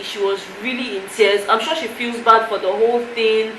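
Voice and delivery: ordinary delivery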